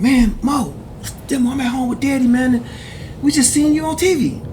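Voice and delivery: high, excited voice of a brother bursting with news. Retold or imitated. Brother calling about seeing him on TV, excited high voice. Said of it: "He said".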